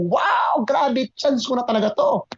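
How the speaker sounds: high, wide-eyed wow; excited gasp. Retold or imitated. Dreaming of riches, a high excited wow. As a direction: marvel at your lucky break. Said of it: "Sabi ko".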